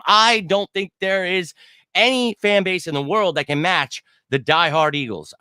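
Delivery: usual speaking voice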